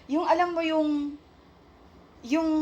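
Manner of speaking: ordinary talk, nothing marked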